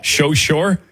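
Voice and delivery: "So sure?" teasingly said as "sho shure?"; mimicked slushy S. Host teases caller's slip on "So sure?", mimicked slushy S. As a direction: echo "So sure?" with SH for the S, rising like a question. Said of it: "a little slushy yes there".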